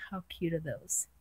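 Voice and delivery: soft unvoiced gush over cute bear paws; whisper. Real, not acted. Whispering delight to viewers over tiny bear paws. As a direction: breathe it out, delighted.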